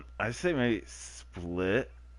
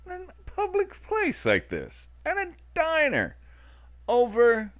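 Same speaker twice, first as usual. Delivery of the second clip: mock outrage; 4 dB louder than usual. Continues outraged rant.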